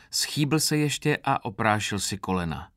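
plain reading voice

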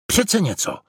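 high-pitched exclamation of discovery; excited cry. A narrator's reading. Franta spots something on the back wall, enthusiastic exclamation. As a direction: cry out with delight at a find. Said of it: "vykřikl nadšeně".

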